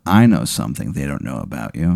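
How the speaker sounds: low, hushed taunt; quiet threat, nose to nose; menacing. Acted. Rival leader leaning close to the player, menacing.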